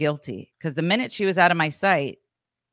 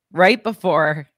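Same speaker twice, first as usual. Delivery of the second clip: usual speaking voice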